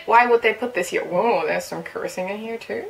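ordinary talk, nothing marked

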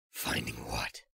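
Snake's voice. Acted. Old soldier explains his pursuit to Drebin, low and weary. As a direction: drop into the tired veteran's low voice.

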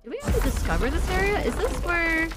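suddenly wondering if she has been here; surprised excitement. Real, not acted. Streamer exploring the map, startled she may have been here, excited. Realizing map area seems familiar.

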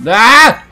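short yell of alarm; startled yell. Real, not acted. Player startled by a game enemy, startled yell.

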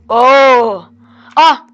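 loud startled cries. Real, not acted. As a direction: jump and shout. Startled by monster.

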